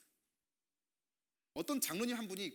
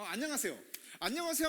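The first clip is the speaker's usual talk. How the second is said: bright, higher hello, louder on the second; cheery greeting; 6 semitones higher, 4 dB louder than usual. Shown as a demonstration. Pastor greets the congregation and wants a cheerful answer. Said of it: "하면 너 어떻게 해야 돼?".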